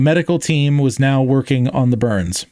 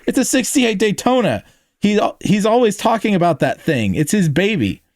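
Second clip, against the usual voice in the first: high, aghast explanation; alarmed and incredulous, easing down at the end; gasping disbelief; 8 semitones higher than usual. A narrator's reading. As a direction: protest in shock, pitched well up.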